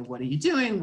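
normal talking voice

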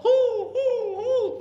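high, loud owl hoots with a laugh; owl hooting. Retold or imitated. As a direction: hoot like an owl in the night. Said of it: "And the owl".

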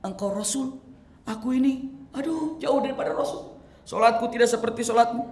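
tearful lament. Acted. Thauban explaining his tears to the Prophet, pleading lament. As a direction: plead your fear of losing him.

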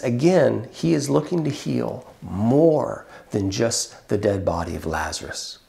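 ordinary talk

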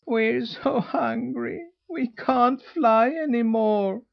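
high, slow, whimpering gosling voice. A narrator's reading. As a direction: whimper it like starving little geese. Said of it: "whimpered the goslings".

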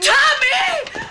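a very high, loud alarmed cry; wife screaming for her husband as fire starts; calling out. Acted. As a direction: cry his name in alarm.

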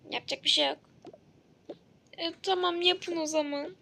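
usual speaking voice

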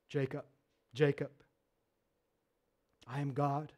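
hushed, low and solemn divine call; voice of God. Acted. God calling to sleeping Jacob, hushed and solemn. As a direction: speak softly and low, like a voice in the night.

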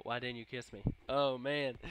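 ordinary delivery

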